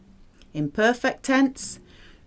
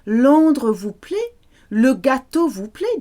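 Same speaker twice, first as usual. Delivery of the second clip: higher, louder rising questions; asking question; 5 semitones higher, 6 dB louder than usual. Shown as a demonstration. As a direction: offer each question brightly to a guest.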